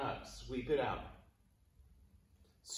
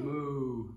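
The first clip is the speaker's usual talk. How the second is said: drawn-out cow moo; 3 dB louder than usual. Shown as a demonstration. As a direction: let out a long moo. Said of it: "Cow pose".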